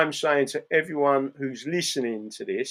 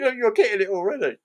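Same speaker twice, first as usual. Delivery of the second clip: laughing; 6 semitones higher, faster than usual. Real, not acted. Laughing while retelling a joke.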